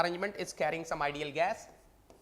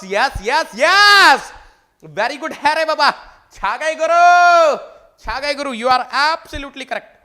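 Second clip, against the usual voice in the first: high, loud delighted cheer; excited praise; 6 semitones higher, 15 dB louder than usual. Real, not acted. Teacher excitedly praising a student's correct answer. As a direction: celebrate the right answer.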